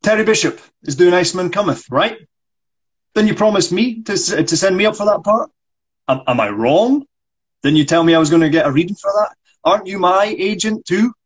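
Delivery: a touch of an indignant actor; frustrated actor. Acted. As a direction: insist on being heard. S1 reads Michael.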